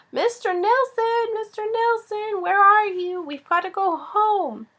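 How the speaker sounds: high, drawn-out holler; Pippi's shrieking call. A narrator's reading. Pippi calling her monkey to go home, shrieking. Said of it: "she was shrieking at the top of her voice".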